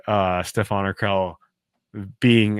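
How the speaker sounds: ordinary delivery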